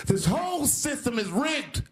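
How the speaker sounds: hammed-up billionaire yelling "This whole system is rigged"; mock Trump scream. Comic mimicking Trump raging at the debate, mock scream. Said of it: "screaming at the top of his lungs".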